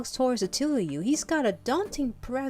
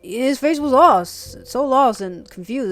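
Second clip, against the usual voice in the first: loud, halting storyteller; Gran the tiger; 10 dB louder, slower than usual. Acted. Tiger recounts waking a stranger to the dragon, loud and drawn-out. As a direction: push the tale out big, lingering on phrases.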